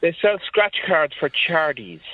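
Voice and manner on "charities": "chardies" for charities, with a D for the T; T softened to D. Shown as a demonstration. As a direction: swallow the middle of charities into "chardies". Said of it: "correct pronunciation of T in that".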